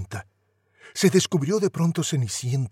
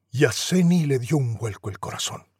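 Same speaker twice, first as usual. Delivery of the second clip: ordinary delivery, nothing marked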